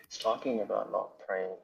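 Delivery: faint, cutting-out phone line; radio breaking up. Real, not acted. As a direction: voice fading in and out on a bad connection. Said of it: "I don't know why your voice is gone".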